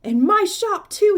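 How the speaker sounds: high, loud proud yell. A narrator's reading. Shopkeeper to everyone, yelling with pride. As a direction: yell it out, beside himself with joy. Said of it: "he yelled".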